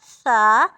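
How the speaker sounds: सा said loud with a soft, thin s; a gentle, soft सा; सा with a light, fine hiss. Shown as a demonstration. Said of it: "ये नरमी वाला लफ्ज़ है".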